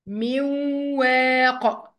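"من واق" as miwww-waaq, no n; nun merged into waw. Shown as a demonstration. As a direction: hold a doubled nasal w across "من واق". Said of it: "هكذا".

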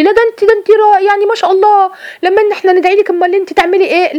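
high, rising, over-the-top admiration; gushing praise. Retold or imitated. Flattering friend praising a sister's words, gushing high. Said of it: "يثني عليكي صاحبتك".

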